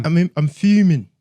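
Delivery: mock London roadman accent. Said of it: "Top Boy shit".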